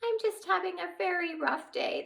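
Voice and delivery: high, small and weary; tiny fairy admitting a bad day; tiny sad fairy.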